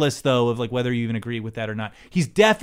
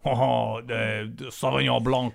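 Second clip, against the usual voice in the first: mock French wine-snob voice; hammy French pronunciation; French accent. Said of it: "your French listenership".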